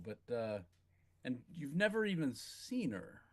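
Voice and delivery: ordinary talk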